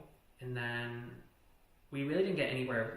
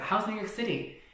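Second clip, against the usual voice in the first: high-pitched retold taunt; mocking question; 8 semitones higher, 3 dB louder than usual. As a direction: ask it with mock curiosity, pitched up. Acted taunt catching partner lying.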